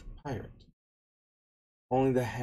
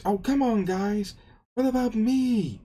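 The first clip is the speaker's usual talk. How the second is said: high, loud pleading complaint; whiny protest; 9 semitones higher, 6 dB louder than usual. Retold or imitated. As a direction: whine loudly at your friends for leaving you out. Luffy protests to his crew.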